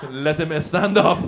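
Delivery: put-on commanding dad voice with a Persian accent; father like Darth Vader. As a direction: intone it like a movie villain father. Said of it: "my father's voice, like Darth Vader".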